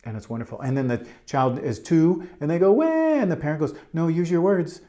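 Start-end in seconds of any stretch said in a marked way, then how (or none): 2.7-2.9 loud, high, drawn-out mock cry; toddler's wail. Teacher acts out a two-year-old crying to a parent, mock wail. 3.9-4.8 higher, louder, lightly acted parent voice; a hint of firm parental coaching; parent correcting toddler. Parent prompts a wailing two-year-old, mildly firm.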